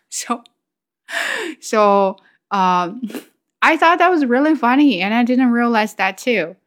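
usual speaking voice

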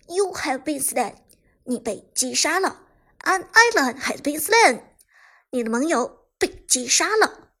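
a hint of in-game kill announcer. A narrator's reading. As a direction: announce kills like a game's voice-over, building in volume. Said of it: "是王者荣耀的旁白".

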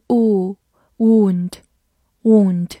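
"wound" with a long oo; slow, drawn-out oo in "wound"; long U vowel. Shown as a demonstration. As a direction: stretch "wound" as woond, slowly. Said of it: "合发长音U".

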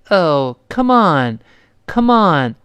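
slow "come on" with a long, heavy "on"; encouraging COME ON. Shown as a demonstration. Teacher models cheering someone up, encouraging "come on". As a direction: coax with a drawn-out "on". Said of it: "就鼓励对方们别泄气".

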